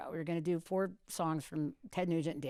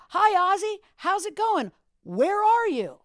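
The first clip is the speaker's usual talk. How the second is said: high, loud cheery announcer pitch; bright on-air greeting; radio host voice; 12 semitones higher, 8 dB louder, slower than usual. Retold or imitated. DJ greeting [name] cold on live radio, cheery announcer. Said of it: "So I'm like".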